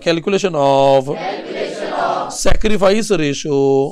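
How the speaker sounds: slow, low, stretched-out words; drawn-out class prompt. Real, not acted. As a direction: draw it out for the class to join in. Teacher cueing class to chant along. (वर्गाला सोबत म्हणायला लावत, शब्द लांबवत)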